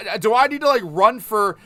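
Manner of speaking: usual speaking voice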